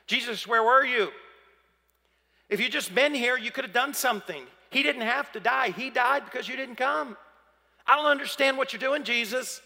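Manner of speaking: high, insistent reproach; frustrated. Acted. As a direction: demand answers, frustrated and pleading. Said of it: "incredible frustration in what she expresses".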